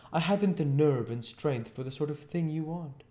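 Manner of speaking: high-pitched, pleading weakling; plaintive, high-voiced excuse; miserable Mr Marvel. A narrator's reading. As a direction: beg off the job, pitched high.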